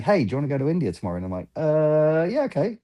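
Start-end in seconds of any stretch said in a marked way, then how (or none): 0.0-1.2 quick, higher-pitched casual offer; breezy invitation. Pretend. Someone inviting a friend abroad tomorrow, breezy and quick. 1.6-2.8 drawn-out high "uh", then agreeing; mock-uncertain, high-pitched yes; hesitant agreement. Answering a sudden invite to India, hesitant then game.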